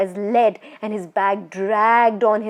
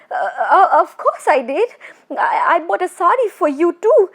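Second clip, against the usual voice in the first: panicked stammer; 8 semitones higher, 6 dB louder than usual. Acted. Terrified man lying quickly.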